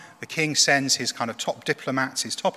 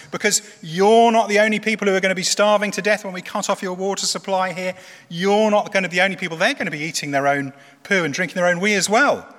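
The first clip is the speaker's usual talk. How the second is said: loud and higher, shouting up to the wall; raised voice; 5 semitones higher, 4 dB louder than usual. Acted. Field commander shouting at the soldiers on the wall, raised voice. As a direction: bellow it so everyone on the wall hears. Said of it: "raises his voice at this point".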